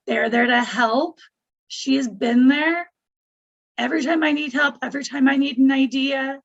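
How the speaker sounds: ordinary talk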